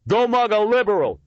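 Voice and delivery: high, loud jeering shout; beefy young man jeering the homeless. A narrator's reading. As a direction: shout it for laughs. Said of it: "shouted a beefy young man".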